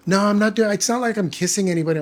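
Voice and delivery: retold defensive denial. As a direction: imitate a girlfriend brushing off blame. Girlfriend's defensive quote in retold call.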